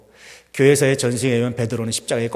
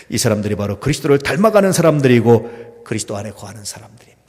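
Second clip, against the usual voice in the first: ordinary delivery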